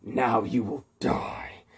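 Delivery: slow threatening whisper; menacing whisper. A narrator's reading. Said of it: "and he goes".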